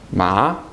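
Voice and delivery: "Ma" from low, swooping upward; rising tone. Shown as a demonstration. Teacher saying the word for hemp, voice rising. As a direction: raise "Ma" as if surprised. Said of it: "Your voice goes up".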